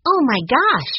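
high, loud sudden gasp of realisation; a sudden discovery bursting out; surprised cry. A narrator's reading. Violet realising the riddle's meaning, surprised cry. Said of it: "she cried".

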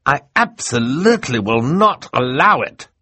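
high, loud, emphatic refusal; gasping protest. Acted. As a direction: refuse absolutely, hitting each key word. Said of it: "Mister Poe finally gasped".

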